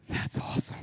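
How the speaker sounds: hushed, soft marvel; acted breathy dad whisper; awed whisper. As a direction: whisper it in secret admiration. Dad secretly awed by the crash.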